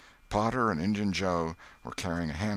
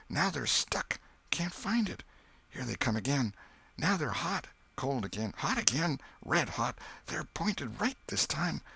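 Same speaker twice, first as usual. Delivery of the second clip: tense; 9 semitones higher than usual. Acted. Boy tracks lights in terror.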